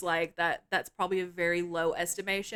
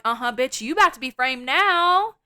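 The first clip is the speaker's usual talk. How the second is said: higher, louder sassy mockery; gloating taunt; 4 semitones higher, 6 dB louder, faster than usual. Voicing the scene mocking Stacy, loud high sassy gloat. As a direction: taunt her like the movie is laughing at her. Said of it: "And it's like".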